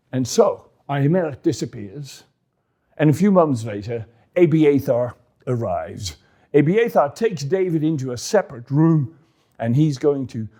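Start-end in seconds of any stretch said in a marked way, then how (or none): none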